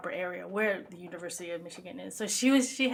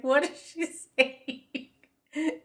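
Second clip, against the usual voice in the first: puzzled retold question; 8 semitones higher, 5 dB louder, much slower than usual. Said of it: "I was like".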